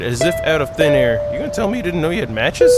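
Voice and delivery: ordinary delivery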